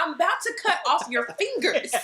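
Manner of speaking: high, loud joking threat; mock threat. Wife joking threat at husband, mock threat. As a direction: threaten him playfully, almost laughing.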